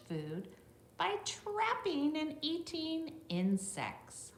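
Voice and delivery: bright read-aloud voice for a class; reading to children. Acted. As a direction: read it out clearly for the little ones. Reads the book text to children.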